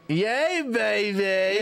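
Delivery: camp it up like a swinging sixties spy; Austin Powers impression. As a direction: cohost riffs on accents, hammed-up British cheer. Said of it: "Little Austin Powers or something".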